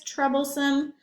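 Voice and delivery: ordinary delivery, nothing marked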